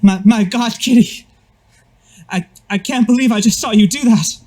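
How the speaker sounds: high, loud, amazed gushing; excited NPC voice. Acted. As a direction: gush in shock and awe. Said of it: "he's saying".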